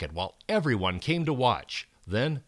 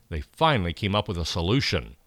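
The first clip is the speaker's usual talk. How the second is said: usual speaking voice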